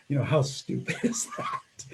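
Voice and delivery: laughing at himself. Real, not acted. Laughing at his own tantrum.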